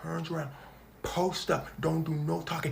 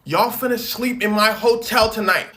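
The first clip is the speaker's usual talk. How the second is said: loud pushy offer; 4 semitones higher, 11 dB louder than usual. Retold or imitated. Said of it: "Lindsay started talking about some".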